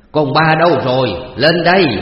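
loud, high panicked shout; frantic calling. Acted. Panicked father shouting for the servant girl, loud high call. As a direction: yell up the house for the servant. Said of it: "lính quýnh chạy ra chạy vô kêu con đầy tớ".

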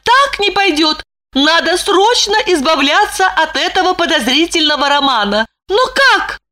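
high, frantic inner worry; anxious wife. A narrator's reading. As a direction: spiral into panic. Lipochka's anxious thoughts.

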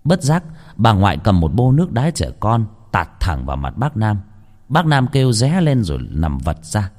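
plain reading voice

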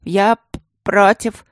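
loud stammered refusal; categorical. A narrator's reading. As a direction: blurt out a firm no. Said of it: "категорически заявил принц".

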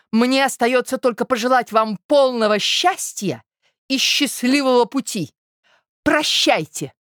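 put-on scornful young lady. Zina bids a fool farewell, scornful. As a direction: wish him well with open contempt. Voices Zina's parting speech.

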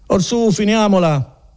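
high, drawn-out exhortation; impatient order. Acted. Policeman to Renzo who stalls, impatient order. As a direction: raise the pitch and stretch it impatiently. Policeman cuts the protest short.

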